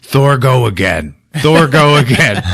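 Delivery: slow, taunting brute voice; Hulk. Retold or imitated. Hulk mocking Thor after his fall, slow taunt. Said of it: "Hulk starts laughing. He's like".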